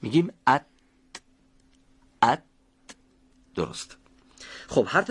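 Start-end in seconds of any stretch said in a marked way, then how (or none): 0.5-2.4 ات cut off on a light, voiceless t; stopped, voiceless t. Shown as a demonstration. Teacher demonstrating te, crisp voiceless stop. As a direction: block ات at the t, then release without voice.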